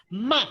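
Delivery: "Ma" high and loud, dropping sharply; falling tone. Shown as a demonstration. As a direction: bark "Ma" with a steep fall. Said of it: "pronounce after me".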